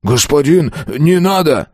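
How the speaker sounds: high-pitched begging; frightened plea. A narrator's reading. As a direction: beg him to stop. (испуганная мольба)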